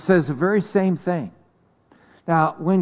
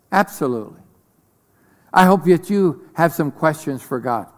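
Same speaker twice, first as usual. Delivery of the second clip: ordinary delivery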